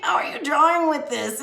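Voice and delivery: high, loud, laughing disbelief; mock exasperation. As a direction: imitate yourself exclaiming at a student's useless pencil. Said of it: "I'm like".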